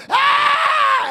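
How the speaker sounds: loud high scream; piercing high cry; demon's final scream. Retold or imitated. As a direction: shriek as he falls out. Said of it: "finally he goes".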